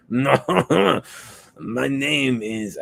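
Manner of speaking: put-on high, slow French troll accent. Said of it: "a French cousin".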